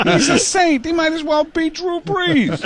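slow, loud, high booming Madden; drawn-out gushing Madden; Madden impression. Madden realizing kids find the comedian funny, booming impression. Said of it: "light bulb goes on over Madden's head".

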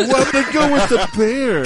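Snagglepuss. Retold or imitated. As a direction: ham up the cartoon lion. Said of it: "I'm gonna give you my underdog pick".

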